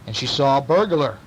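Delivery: plain reading voice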